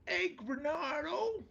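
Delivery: imitating Rebo's mom again. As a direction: stretch it like a mom calling across the yard. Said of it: "What about when Rebo did his mom?".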